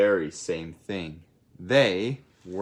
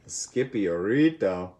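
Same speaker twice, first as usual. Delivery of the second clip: ordinary delivery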